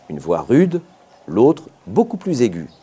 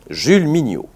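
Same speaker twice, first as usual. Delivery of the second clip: plain reading voice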